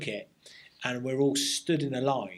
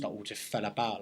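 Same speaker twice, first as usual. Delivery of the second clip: ordinary talk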